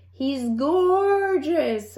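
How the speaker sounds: slow, tender praise; adoring. A narrator's reading. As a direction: linger over it lovingly. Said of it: "agreed Dot".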